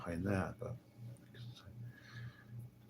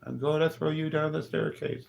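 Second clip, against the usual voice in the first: high, louder hammed-up threat; mock threat; 9 semitones higher, 5 dB louder than usual. Jokingly threatening the talker, high and loud. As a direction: threaten him in jest.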